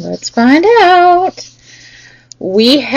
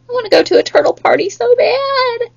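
mock whine; 8 semitones higher, 5 dB quieter than usual. Teacher whines playfully to kids.

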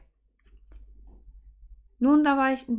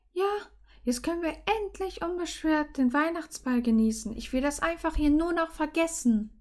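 high, light character voice; higher-pitched, wistful young heroine; relieved heroine; 6 semitones higher than usual. Acted. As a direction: answer him in a raised, girlish pitch. Heroine's dialogue voice.

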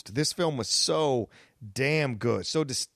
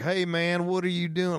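Western accent; 6 semitones higher than usual. Shown as a demonstration. Podcaster demoing a Western movie accent, mock Western accent. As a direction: greet him like a drawling Western cowboy accent. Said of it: "in a Western accent".

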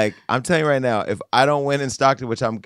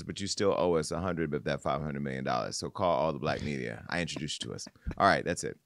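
ordinary talk

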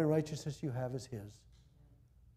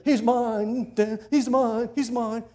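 much higher, loud gloating devil; Satan cussing; 7 semitones higher, 9 dB louder, much slower than usual. Acted. Satan gloating over Moses, loud and high. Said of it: "Satan is dancing on the grave".

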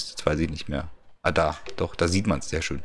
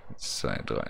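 whispered counting; 11 semitones lower, 9 dB quieter than usual. Real, not acted. Counting blocks, suddenly whispered.

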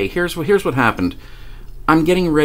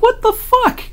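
much higher, startled what the fuck; baffled out loud. Retold or imitated. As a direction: blurt it out in amused disbelief. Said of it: "I literally say out loud".

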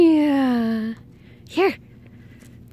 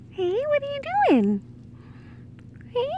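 high pet voice; 12 semitones higher, 6 dB quieter, faster than usual. Real, not acted. Talking to a pet.

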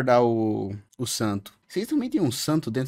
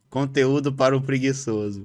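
usual speaking voice

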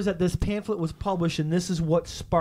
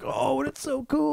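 higher, slow gushing delight; mock gushing; 8 semitones higher, much slower than usual. Fan gushing over a tiny ash can, mock delight. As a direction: coo over a tiny comic, drawn out. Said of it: "You're like".

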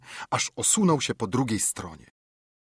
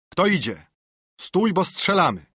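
higher, louder call of warning; sentry's challenge; 8 semitones higher, 5 dB louder than usual. A narrator's reading. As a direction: bark the challenge at the pirate. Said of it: "I zawołał na korsarza". (głośne, wyższe wołanie wartownika)

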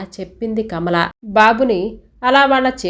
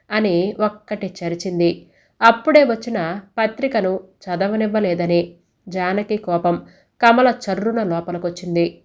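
plain reading voice

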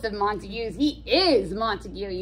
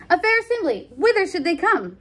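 much higher, airy question; mock casual; 9 semitones higher than usual. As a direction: fake indifference about the party. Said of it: "comedic moment".

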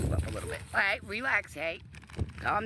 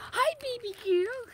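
much higher sing-song pet voice. Real, not acted. Owner coaxing a timid dog closer in high baby talk.

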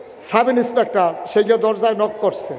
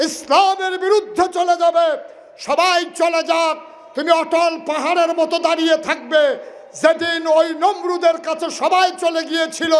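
preaching at full force; 9 semitones higher than usual. Acted. Preacher calling out to the villagers of Lakshmipur, fiery and high. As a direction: rouse the crowd like a fiery preacher. Impassioned climax of the waz.

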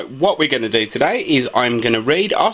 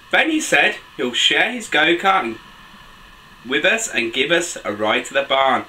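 put-on animated children's story reading, high excited exclamations; picture-book narration; 4 semitones higher than usual. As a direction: read to little ones, pitch leaping between narration and exclamations. Reads picture book aloud to children.

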